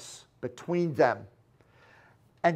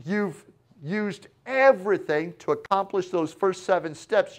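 normal talking voice

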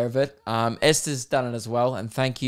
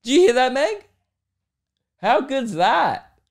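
excited; 12 semitones higher, 4 dB louder than usual. Real, not acted. Calling Meg over to hear the jingle.

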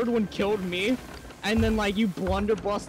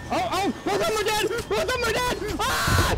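panicked yelling; 4 dB louder than usual. Real, not acted. Panic as teammates die.

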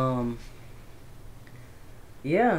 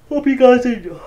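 higher, louder, hammed-up farewell; overblown sign-off; 9 semitones higher, 11 dB louder than usual. Real, not acted. Wrapping up the video for viewers, a loud hammed-up goodbye. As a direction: blurt the goodbye big and overdone. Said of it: "Okay, hold on".

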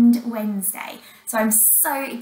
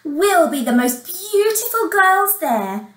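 high, raised boastful voice; chorusing stepsisters; 8 semitones higher, 5 dB louder than usual. Acted. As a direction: show off gleefully. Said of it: "chorused the stepsis-, stepsisters".

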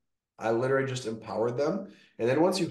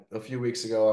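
normal talking voice

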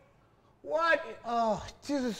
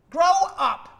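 louder and higher, pushing it further; louder higher shout; 7 semitones higher, 10 dB louder than usual. Acted. Therapist yells again at the patient, louder and higher. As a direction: yell it again, harder and higher.

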